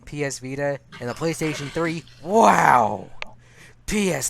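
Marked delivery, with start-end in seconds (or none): 2.2-3.1 surprised wow. Real, not acted. Loud surprised exclamation.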